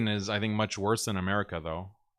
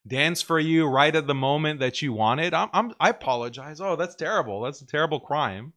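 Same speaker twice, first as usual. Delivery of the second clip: higher, louder, over-apologetic; sarcastic sorry, raised and fast; sarcastic mock apology; 6 semitones higher, 5 dB louder than usual. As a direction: ham up a grovelling apology.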